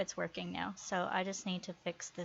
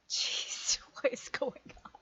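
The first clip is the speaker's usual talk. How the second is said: whispered exasperation; breathy whisper of disbelief; 5 semitones higher than usual. Real, not acted. Whispers at the bug.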